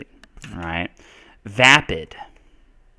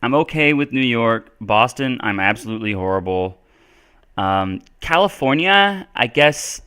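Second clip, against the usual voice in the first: normal talking voice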